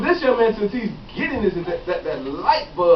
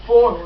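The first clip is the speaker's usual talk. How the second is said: "for" said louder, held out as the key word; stressed word; 6 dB louder than usual. Shown as a demonstration. Preacher teaching the congregation to spot "for", stressed. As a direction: lean on "for" so they notice it. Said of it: "y'all see that word".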